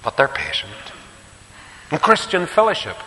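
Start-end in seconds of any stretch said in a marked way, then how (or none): 1.9-3.0 emphatic announcement. Acted. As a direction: lift it up like a new heading. Preacher announces next point.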